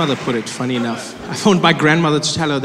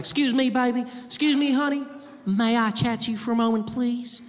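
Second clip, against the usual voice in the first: much higher Southern belle voice; Southern belle accent; 10 semitones higher, 3 dB quieter than usual. Retold or imitated. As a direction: play a high-pitched Southern belle, sweetly imitated. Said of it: "A classic Southern belle".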